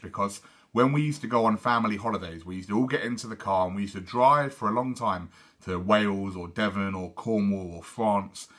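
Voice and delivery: ordinary delivery, nothing marked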